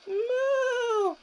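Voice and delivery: high, drawn-out mock moo; playful storybook moo; cow moo. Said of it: "said Cow".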